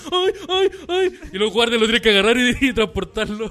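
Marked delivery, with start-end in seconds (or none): 0.1-1.2 high, loud mock wailing ay. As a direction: wail it like an old crying star. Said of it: "se pone a llorar y empieza".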